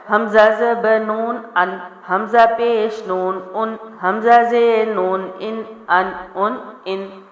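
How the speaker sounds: slow chant of ان with a full clear noon, not nasal and not dropped; clear noon drill. Shown as a demonstration. Teacher shows a fully clear noon, slow drill. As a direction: chant each syllable slowly with a clear noon. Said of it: "نون پورا واضح".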